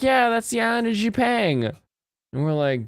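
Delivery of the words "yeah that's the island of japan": imitating Chinese speakers. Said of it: "'Cause the Chinese were like".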